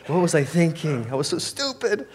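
hammed-up high regret; mock self-reproach. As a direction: voice climbing high in comic dismay.

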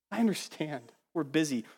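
ordinary talk

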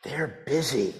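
put-on very soft, small child's voice; little boy. A four-year-old answers the pastor, very soft.

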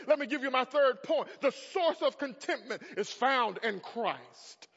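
usual speaking voice

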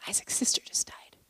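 very soft whispered bad news; grave whisper. Son telling mom of a death, grave whisper. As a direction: break it gently, almost without voice.

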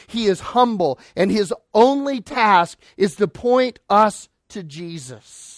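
ordinary talk, nothing marked

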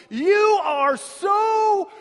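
very loud, high, slow call across the yard; loud child blurt. Retold or imitated. Little boy calling to a passing woman, loud shout. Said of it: "he said very loudly".

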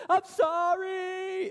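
high, drawn-out screamed apology; hammed-up panicked shriek, stretched long; freaking out. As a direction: imitate a terrified man wailing his apology. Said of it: "he's freaking out".

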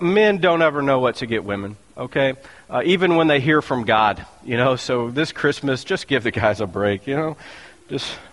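ordinary talk

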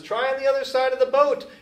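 high, loud call across the water; yelled from shore. Retold or imitated. Jesus calling to the fishermen in the boat, high, loud yell. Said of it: "the voice says".

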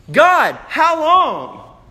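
slow, high, drawn-out plea to God; pleading cry. Acted. Martyrs at the altar begging God, slow high plea. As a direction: stretch out the cry, begging heaven.

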